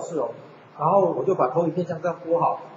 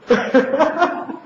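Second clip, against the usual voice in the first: laughing; 9 semitones higher, 4 dB louder than usual. Real, not acted. Laughs at own story.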